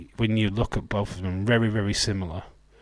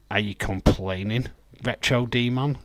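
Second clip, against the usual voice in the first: ordinary talk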